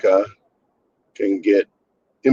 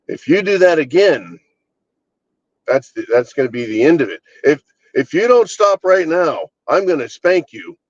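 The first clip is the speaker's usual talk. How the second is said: the imitated parent raises the stakes, higher pitched; escalating parent threat; 5 semitones higher than usual. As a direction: ham up the parent's bigger threat. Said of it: "The parent that says".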